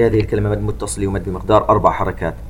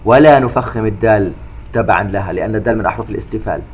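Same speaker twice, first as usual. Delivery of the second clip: normal talking voice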